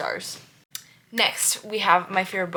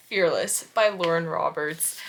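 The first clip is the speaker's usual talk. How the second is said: ordinary talk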